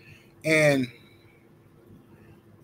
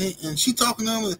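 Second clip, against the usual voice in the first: ordinary talk, nothing marked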